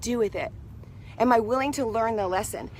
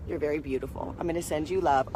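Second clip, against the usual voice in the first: much softer and lower, tender; soft coo to spider; 9 semitones lower, 6 dB quieter than usual. Real, not acted. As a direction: coo gently so the spider stays calm. Cooing softly to spider.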